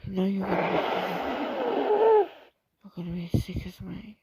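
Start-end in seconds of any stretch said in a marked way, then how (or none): 0.1-2.3 slow, louder, drawn-out warning. Acted. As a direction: stretch it out louder.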